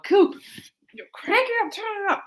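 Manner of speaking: high, loud call to the stage; imitating calling out from the crowd; retold yell. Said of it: "if you hear somebody yell".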